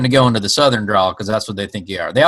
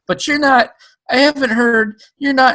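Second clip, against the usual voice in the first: mock disbelief; 4 dB louder than usual. Mock disbelief at a British accent.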